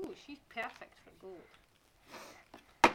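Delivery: slow whispered ooh; breathy admiring whisper. Real, not acted. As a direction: whisper it slowly in awe. Mother admires doll on horse.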